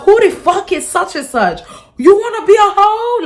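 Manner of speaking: high, loud mock outrage; going off. Mom blowing up over daughter's crush, going off. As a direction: escalate into an indignant screech, imitating mom. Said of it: "she would go off on me".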